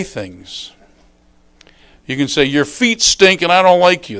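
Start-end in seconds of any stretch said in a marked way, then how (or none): 2.4-4.2 louder, higher, tactless jab; mock harsh put-down; blunt complaint. Shown as a demonstration. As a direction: say it with no cushioning at all. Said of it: "That's one way to say it".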